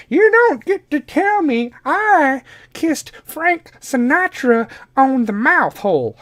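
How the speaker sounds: put-on high, slow, indignant old-lady voice; cranky old grandma. Grandma defending herself to grandkid, high indignant old-lady voice. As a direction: bristle like a proud old grandmother. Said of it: "call bullshit on that, Grandma".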